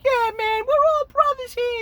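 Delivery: put-on very high, loud drunken gushing; drunk buddy voice. Rhoda hugging the guy he fought, high drunken gush. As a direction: slur it out like a happy drunk.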